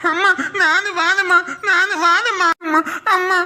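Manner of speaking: put-on crying child. Little son crying for his mother leaving for work, high wail. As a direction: whimper and wail like a small boy.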